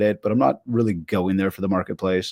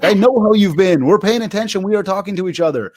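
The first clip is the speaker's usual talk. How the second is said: emphatic; 8 semitones higher, 3 dB louder than usual. Retold or imitated. Friends already keep in touch.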